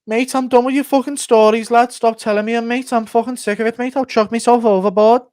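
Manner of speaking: high-pitched, exasperated Darren Till voice; Till impression. Till fed up with Ortega's stories on a fishing trip, high exasperated impression. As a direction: rant high and fed up, threatening to quit. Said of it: "Ortega and Till on a fishing trip impression".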